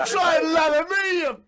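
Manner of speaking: talking tap. Pretend. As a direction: give the hard water a taunting voice. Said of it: "the tap and it says".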